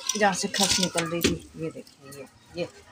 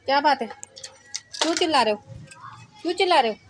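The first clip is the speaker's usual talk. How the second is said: higher, louder call in short falling questions; questioning the noisy one; 5 semitones higher, 3 dB louder, much faster than usual. Real, not acted. Asking the screamer why they are shouting, raised questioning call. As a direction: call over, asking what the fuss is. Calls to someone making noise.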